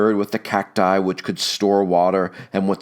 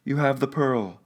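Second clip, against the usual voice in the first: Juana higher, urgent and hoarse; hoarse cry; 8 semitones higher than usual. A narrator's reading. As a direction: cry it out hoarsely to her husband. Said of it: "she cried hoarsely".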